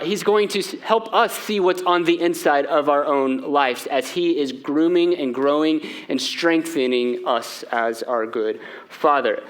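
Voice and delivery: ordinary talk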